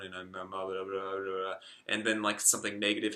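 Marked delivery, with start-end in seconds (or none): 0.0-1.5 monotone. Shown as a demonstration. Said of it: "maybe I'm monotone".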